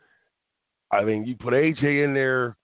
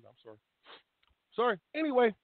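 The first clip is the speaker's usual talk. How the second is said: usual speaking voice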